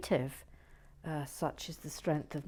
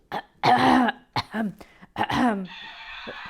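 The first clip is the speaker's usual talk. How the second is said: loud, repeated throat-clearing; showy throat-clearing; 6 semitones higher, 11 dB louder than usual. Pretend. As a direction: clear the throat grandly before performing. Clears throat before showing off accents.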